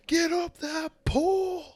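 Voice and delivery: high, slow, loud yelled order; drill sergeant yell. Acted. Furious sergeant yells at the cadet to climb. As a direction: scream it up the pole. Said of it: "being like".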